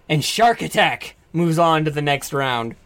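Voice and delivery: ordinary talk, nothing marked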